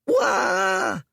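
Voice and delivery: high startled Wah; the boy crying out at Dorian's fangs; frightened cry. Acted. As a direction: yelp in fright. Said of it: "cried the boy".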